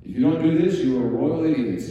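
mock stern ruler laying down the law; dictatorial God. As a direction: bark it like a harsh dictator. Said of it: "like, dictatorial".

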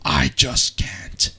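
slow, breathy dramatic whisper. Acted. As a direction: let the line drop to a hush. Dramatic reading, whispered line.

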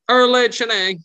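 put-on a squeaky young kid blurting his own name. Squeaky kid Erlade introducing himself to the guards. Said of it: "the squeaky kid".

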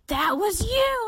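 high squeal of recognition; high-pitched, astonished realization; shocked recognition. Retold or imitated. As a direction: imitate a drunk woman's amazed outburst.

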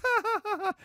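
very high, hammed-up laughing crowd; mock audience laughter. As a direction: laugh at the naive virgin. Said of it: "the audience would all be like".

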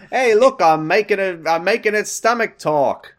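very high, loud, silly; silly clown. Acted. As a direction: goof around like a clown.

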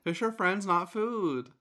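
high, loud quoted shark motto; film shark. Acted. Co-host quoting Finding Nemo's pacifist sharks, high chanting voice. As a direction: recite the shark pledge high and emphatic. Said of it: "a pacifist shark".

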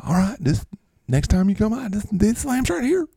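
high, eager parting call; C D Lamb. Acted. C D Lamb leaves still showing lambs, high-voiced. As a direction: keep pitching it up as you go. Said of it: "I'm C D Lamb".

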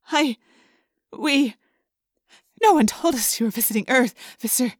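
stammering, flustered woman; nervous babbling. A narrator's reading. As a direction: babble it, faltering on the first words. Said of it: "the woman babbled".

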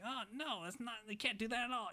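put-on high panicky kid voice; freaking out. Boy panicking that the monsters are his friends, high mock panic.

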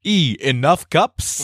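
drawn-out, high comic mover voice; Brooklyn mover. Acted. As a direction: announce the box label, stretching it out. Brooklyn mover bit, reading box letters.

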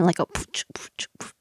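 beatbox the rhythm. Shown as a demonstration. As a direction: mouth beat sounds. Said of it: "put a beat behind it".

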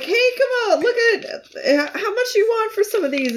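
high, sing-song sweet talk; flirty charming voice. Retold or imitated. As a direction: charm the fruit seller with a bright lilt. Flirty vendor-charming quote.

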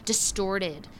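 ordinary talk

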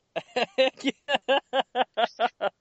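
high-pitched laugh. Real, not acted. Laughing at the chef voice.

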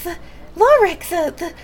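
high, loud, breathless rush; winded Radcliffe. Acted. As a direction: blurt it out, out of breath and excited. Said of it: "very windedly".

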